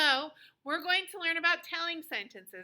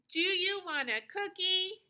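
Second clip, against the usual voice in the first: higher-pitched question, lifting on COOKIE; question intonation; 6 semitones higher than usual. Shown as a demonstration. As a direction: lead the class in asking, voice raised at COOKIE. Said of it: "do the whole sentence for a question mark".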